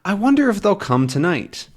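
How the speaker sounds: high-pitched musing; wondering. Acted. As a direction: wonder aloud in a lifted voice. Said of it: "he's wondering".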